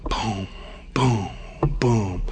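low, soft booms imitating footsteps; heavy footsteps voiced as spaced, hushed booms; echoing footstep booms. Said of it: "every footstep echoing".

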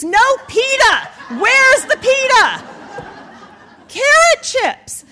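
loud, high-pitched indignant cry; outraged rant. Retold or imitated. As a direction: shout it like a comic food outrage. Outraged rant over the snacks.

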